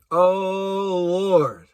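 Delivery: normal talking voice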